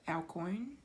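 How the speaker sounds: "El Coin" as a tentative question, rising on Coin; unsure pronunciation. Real, not acted. Card reader guessing at an oracle card's name, unsure pronunciation. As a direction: try out "El Coin", lifting the end. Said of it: "don't know how to pronounce that".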